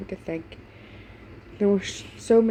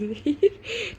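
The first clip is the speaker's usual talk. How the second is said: laughing; 11 semitones higher than usual. Real, not acted. Reader laughs.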